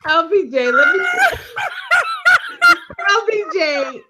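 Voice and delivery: high laughing shriek, can barely speak; real helpless laugh-scream; shrieking laughter. Real, not acted. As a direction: lose it completely, squealing through the words.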